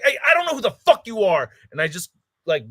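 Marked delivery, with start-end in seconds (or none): 0.0-1.5 loud, high, fast brush-off; a quick, raised dismissal; dismissive shout. Retold or imitated. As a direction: bark it fast to wave him away. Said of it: "And I'm like".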